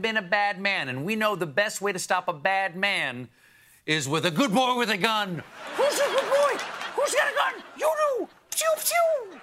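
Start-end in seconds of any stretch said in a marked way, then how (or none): 5.8-9.4 very high cooing dog-talk voice; baby talk to a dog. Acted. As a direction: coo it like praising a puppy.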